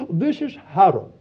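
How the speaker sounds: lightly imitated posh phone voice; a hint of posh caller; terribly posh. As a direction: put on a posh English accent. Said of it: "a terribly posh voice said".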